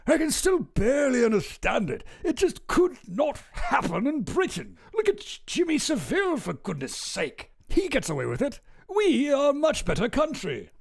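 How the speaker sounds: put-on high, theatrical upper-class English accent; mock the indignant British gentleman, pitched high; hammed-up posh British outrage. Streamer hamming up the politician's outrage in a high posh British accent. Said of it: "I just assume he talks like this".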